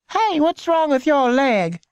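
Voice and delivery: put-on high, slow child voice; a small girl calling to a stranger; high childish question; curious little girl. A narrator's reading. Little girl asks Lenny.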